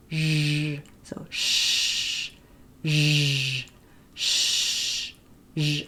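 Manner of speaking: "shh" hissed, "zh" buzzed; "zh" with a voiced buzz; sh and zh contrast. Shown as a demonstration. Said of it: "the voiced version of that is zh".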